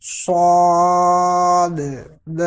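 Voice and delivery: صاد long, final د echoing; saad with echo. Shown as a demonstration. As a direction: stretch صاد, bounce the د. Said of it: "اب دوبارہ سنیں دھیان سے".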